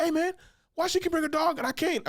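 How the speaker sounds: high, whiny imitation of an upset man; mocking the dude. Imitating a customer upset about the dog rule, high whiny mock. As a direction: pitch way up and complain like him.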